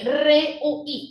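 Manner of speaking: "re, U, I" broken apart, each sound on its own; syllables separated. Shown as a demonstration. Teacher showing pupils a faulty split, syllables separated. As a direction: say "re, U, I" with a gap between the pieces. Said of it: "I la han separado".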